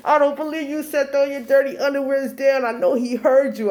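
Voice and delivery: high, loud mock wail; imitated crying. Girl tells mom the crush heard, mock crying. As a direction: wail it like a mortified teenager. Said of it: "I'm crying".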